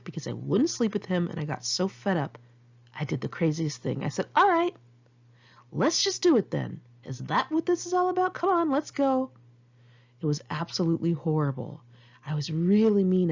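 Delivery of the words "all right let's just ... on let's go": exasperated retort. Retold or imitated. Fight with Andrew, quoted retort.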